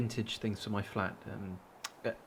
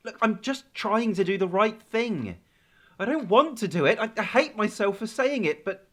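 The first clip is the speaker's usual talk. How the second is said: distressed pleading; 9 semitones higher, 9 dB louder than usual. Acted. Pleading through closed door.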